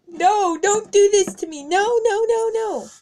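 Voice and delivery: high, loud frantic begging; panicked pleading. Acted. Pony begging her attacker, high and panicked. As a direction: beg for your life in a high panic.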